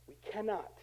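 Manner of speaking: hushed repeat. Real, not acted. Preacher letting the point sink in after insisting, hushed. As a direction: drop right down and say it quietly.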